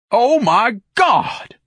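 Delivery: drawn-out, high, loud outraged cry; bellowed. A narrator's reading. Ignatius sees the amateur paintings, bellowing outrage. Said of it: "Ignatius bellowed".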